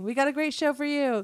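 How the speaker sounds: SNL host opener. Acted. Co-host mimics an SNL opening, high-pitched host voice. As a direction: open the show like an SNL host. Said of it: "it's an S N L thing".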